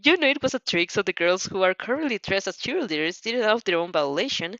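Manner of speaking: ordinary talk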